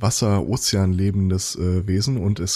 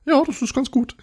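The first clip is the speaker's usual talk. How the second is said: put-on much higher, playful child praise; praising a toddler. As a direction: coo it at a small child. Mimicking adult praising a child.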